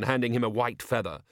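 usual speaking voice

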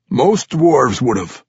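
loud, high gruff dwarf scoff; gruff old dwarf warrior. Acted. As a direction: scoff like a crusty old veteran. Old warrior Ragged Dane voiced.

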